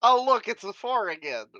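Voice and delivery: dry sarcastic groan; deadpan annoyance at the dice. Real, not acted. Bad dice roll again.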